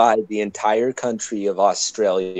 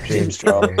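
man doing a woman's voice; 9 semitones higher, 3 dB louder than usual. Pretend. Mimics beauty vlogger voice.

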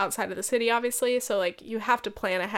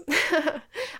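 higher laugh escaping after holding it back; fake-sounding laugh; 6 semitones higher than usual. Real, not acted. Podcaster resisting the urge to laugh, fake-sounding laugh. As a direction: let out a laugh that sounds put-on. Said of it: "I think it's that laugh".

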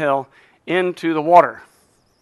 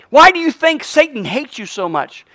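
ordinary talk, nothing marked

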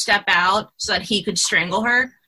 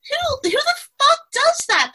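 high-pitched, incredulous exasperation; scandalized, pitch leaping up; exasperated outburst. Real, not acted. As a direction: burst out in indignant disbelief. Ranting about title cards.

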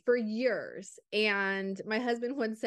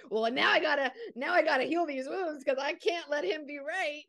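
higher, laughing while vowing; laughing mock resolve; 6 semitones higher than usual. Joking about not letting husband be right, laughing. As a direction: laugh through a jokey vow. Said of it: "I mean, I'm kidding".

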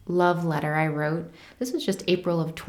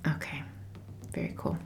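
soft whisper to herself; whispered aside; 3 semitones lower, 12 dB quieter than usual. Real, not acted. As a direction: murmur it under the breath while writing. Quiet aside while writing.

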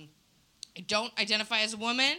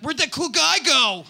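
loud, higher, drawn-out call; imitating baffled men calling out; mock bewildered call; 5 semitones higher, 11 dB louder, slower than usual. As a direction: call out as if searching. Men losing sight of him.

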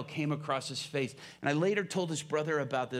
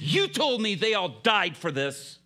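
loud, raised-pitch protest; impassioned challenge; 5 semitones higher, 7 dB louder than usual. Retold or imitated. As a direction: throw his own teaching back at him forcefully. Prisoner challenges preacher with Bible.

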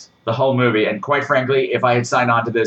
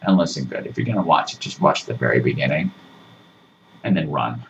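mock stern daddy; 9 semitones lower than usual. Telling viewers to flee, low hushed mock warning. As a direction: drop down low like a spooky stern parent.

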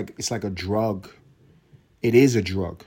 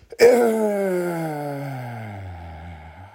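long wailing sigh; 4 semitones higher than usual. Real, not acted. Long exasperated groan.

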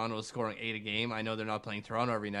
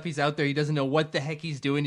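ordinary delivery

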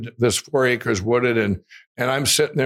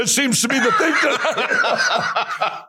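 high, slow, laughing through the line; amused at the coincidence, slowing down; 12 semitones higher, slower than usual. Real, not acted. Laughing at the punchline.

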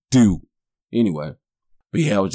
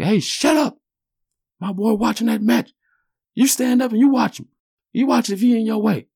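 dad standing up. Retold or imitated. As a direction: tell the man off like a proud dad.

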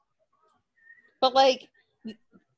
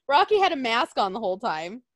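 ordinary delivery

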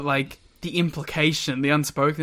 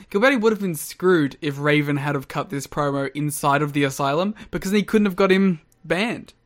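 usual speaking voice

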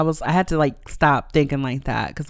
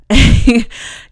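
a burst of laughter, higher and louder; loud real laugh; 8 semitones higher, 9 dB louder than usual. Real, not acted. Laughing at her own comeback.

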